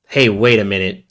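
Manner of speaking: ordinary talk, nothing marked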